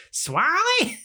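very high, puzzled Canadian echo; impersonated Canadian accent. Retold or imitated. Canadian driver repeating the unknown word, hammed-up accent. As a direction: imitate a baffled Canadian. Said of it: "his impersonation of a Canadian".

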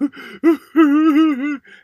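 loud, high fake sobbing wail; mock bawling. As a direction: bawl in an exaggerated comic sob. Imitates crying at customers with packages.

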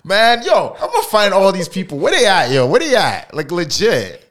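loud, high-pitched playful rant; mock outrage. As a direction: call them out loudly, half laughing. Mock hunt for absent viewers.

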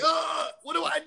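high, slow flustered anime voice; anime character. Retold or imitated. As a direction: whine it like a panicked anime hero. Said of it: "you anime and you're like".

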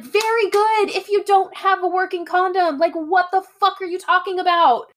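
loud, high-pitched indignant retort; outraged girlfriend. Retold or imitated. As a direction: blast him as the exasperated girlfriend, acted out. Acting out girlfriend's furious retort.

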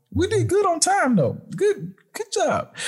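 chirpy high-pitched praise. Real, not acted. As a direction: sing out good job, high. Praising the group.

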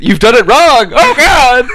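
high, loud hammed-up outcry; mock alarm, pitch leaping up; mock panicked shout. Co-host heckling the dreaded fourth king, mock panicked shout.

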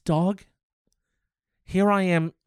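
ordinary delivery

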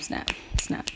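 whispered snap snap, no voice; whispering. Real, not acted. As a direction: breathe the words out unvoiced. Snapping along to article title.

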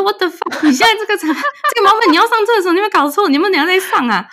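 exasperated. Retold or imitated. Girlfriend berating boyfriend for needing the toilet, exasperated. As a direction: scold him for picking this moment.

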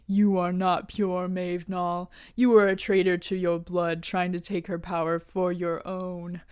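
a hint of an ancient guardian passing judgment; totem guy. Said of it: "the totem guy is like".

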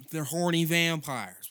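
punchline. Real, not acted.

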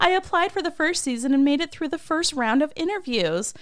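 high-pitched mock fangirl chatter; gushing fan. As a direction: imitate an overeager fan bragging. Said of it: "I'm such a fan".

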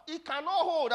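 high-pitched shouted refusal; loud acted rebuttal; forceful contradiction. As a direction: shout the opponent down. Acted quarrel over the meeting.

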